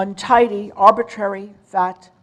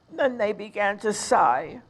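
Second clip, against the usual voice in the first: usual speaking voice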